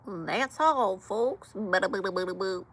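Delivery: ordinary talk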